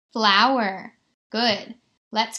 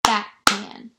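"Batman" in two beats, BAT, pause, MAN; split into syllables; 2 semitones lower than usual. Shown as a demonstration. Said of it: "or our hands to clap".

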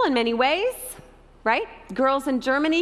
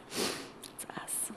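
whisper; 17 dB quieter than usual. Acted. Intimate whisper to congregation.

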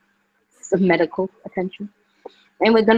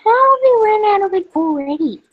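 shocked; 12 semitones higher, 4 dB louder than usual. Real, not acted. Friend out of supplies.

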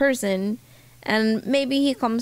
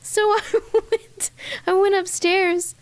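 laughing; 11 semitones higher than usual. Real, not acted. Laughing while retelling story.

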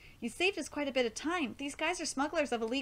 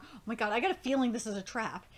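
ordinary talk, nothing marked

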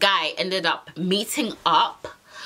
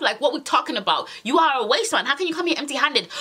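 higher-pitched indignant telling-off; retold angry rant; 5 semitones higher than usual. Woman scolding a man who came empty-handed, retold angry rant. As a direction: lay into him with mounting outrage, re-enacted. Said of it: "I was so angry".

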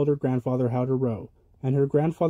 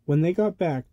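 ordinary delivery

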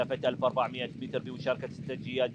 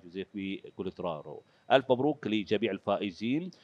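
lower, formal results announcement; booming prize announcer; 4 semitones lower, 3 dB louder than usual. Acted. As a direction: announce the winners with ceremony. Reads official results after race.

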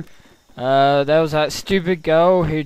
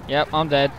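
ordinary talk, nothing marked

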